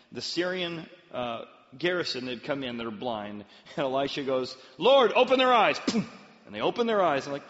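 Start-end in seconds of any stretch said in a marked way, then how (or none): 4.8-5.8 loud, high commanding call; shouted prayer. Acted. Elisha calling on the Lord, shouted prayer. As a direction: cry it out to heaven.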